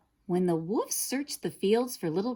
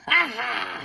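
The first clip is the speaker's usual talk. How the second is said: roared; 5 semitones higher, 4 dB louder than usual. A narrator's reading. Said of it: "he roared".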